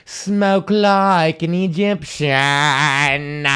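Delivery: very slow, stretched ad slogan; drawn-out slogan. Acted. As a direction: stretch every word like a jingle.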